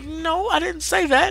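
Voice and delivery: slow, high, disbelieving no; drawn-out high protest; incredulous denial. Retold or imitated. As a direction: spell it out slowly as if he's lost it. Denying boss's claim, incredulous.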